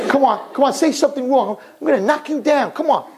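lightly acted would-be boxer itching to fight; mock tough guy. Moviegoer fresh from Rocky picks a fight, cocky mock bravado. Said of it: "they would come out and like".

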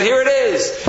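high, excited call; triumphant announcement. Acted. Rebbe returning the money to the woman, triumphantly. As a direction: hand it over with a triumphant cry.

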